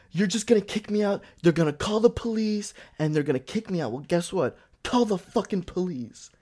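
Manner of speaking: high-pitched mock rant; retold screaming. Woman daring the manager to call the police, high mock screaming. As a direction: imitate her screamed, defiant dare. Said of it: "she starts screaming".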